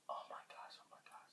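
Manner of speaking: barely audible whispered retold dread; hushed, voiceless mock-fearful whisper; frightened whisper. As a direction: whisper it under your breath, as if scared. Retold fright at approaching man.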